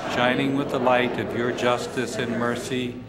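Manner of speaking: ordinary delivery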